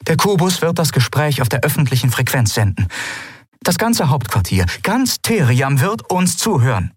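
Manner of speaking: confident officer. Acted. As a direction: lay out the plan with confidence. (selbstsichere Ankündigung)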